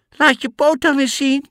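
put-on high, loud little kid voices; goat kids. Goat kids refusing the wolf, high insistent voices. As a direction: insist like scared small goats.

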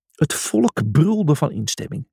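ordinary delivery

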